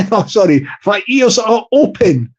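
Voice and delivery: ordinary talk, nothing marked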